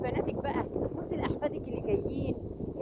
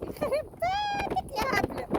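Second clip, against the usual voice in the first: slow, much higher cry of mock despair; exasperated cry; 12 semitones higher, slower than usual. Real, not acted. Wailing at the silly woman in mock despair. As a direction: drag it out high like a wail.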